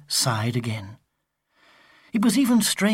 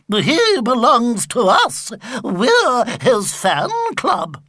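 put-on high, loud, slow old lady insisting; insistent old lady; 7 semitones higher, 4 dB louder, slower than usual. A narrator's reading. Old lady insisting to Mrs Tibblethwaite, high and loud. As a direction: dig in your heels like a stubborn granny. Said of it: "insisted the lady".

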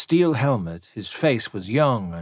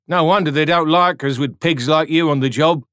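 stern rebuke; 6 semitones higher, 7 dB louder than usual. A narrator's reading. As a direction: put him in his place. Soldier rebuking tan.

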